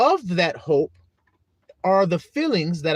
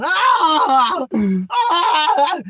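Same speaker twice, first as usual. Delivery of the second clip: high shouted crying wails; mock child tantrum cries; toddler tantrum wail; 10 semitones higher, 6 dB louder than usual.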